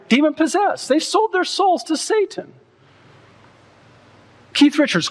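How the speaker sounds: high, declaiming denunciation; fiery preaching. Real, not acted. Preacher denouncing the Rolling Stones, high and forceful. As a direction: condemn them from the pulpit.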